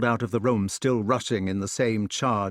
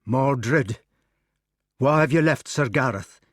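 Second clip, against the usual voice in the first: demanding; 3 semitones higher, 4 dB louder than usual. Acted. Gawain demands answer.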